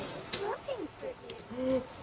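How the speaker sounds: high startled gasp; sharp gasp. Real, not acted. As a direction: gasp in fright. Jump scare.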